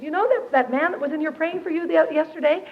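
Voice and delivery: a hint of a giddy roommate; chirpy roommate. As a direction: quote her bubbly boast. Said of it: "She said".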